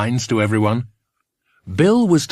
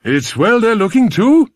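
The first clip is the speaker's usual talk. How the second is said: up-and-down Welsh lilt; louder, rising Welsh farmer; Welsh sing-song; 11 semitones higher, 6 dB louder than usual. Acted. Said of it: "in his up-and-down voice".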